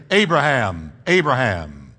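measured, weighty summons; calling for attention. Acted. God getting Abraham's attention, calling his name. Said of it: "really wanting Abraham's attention".

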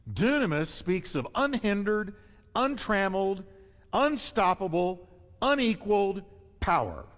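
preacher's rising build on every word; building emphasis. Acted. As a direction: pile up the power words, rising. Preacher building on power words.

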